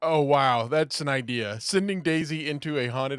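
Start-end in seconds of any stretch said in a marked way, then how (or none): none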